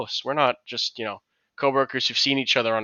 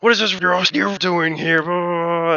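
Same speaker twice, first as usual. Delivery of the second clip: mock drunk guy; 6 semitones higher, 4 dB louder than usual. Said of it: "He's like".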